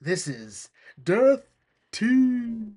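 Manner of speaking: drawn-out, rising villain reveal; grand evil-scheme announcement; gloating villain Mokuba. Acted. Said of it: "Mokuba's already going like".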